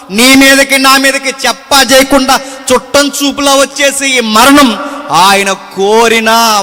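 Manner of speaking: loud, driving sermon cadence with punched phrases; fiery preaching. Acted. Preacher booming to the congregation about the cross. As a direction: preach it hard to the crowd.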